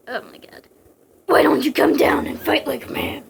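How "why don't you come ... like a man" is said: loud, husky hero's challenge; rough, rasping demand. A narrator's reading. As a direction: growl a challenge up at the villain. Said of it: "Snake demanded".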